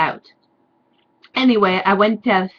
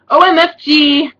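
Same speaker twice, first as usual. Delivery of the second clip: mock squeal; 8 semitones higher, 8 dB louder than usual. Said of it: "I said".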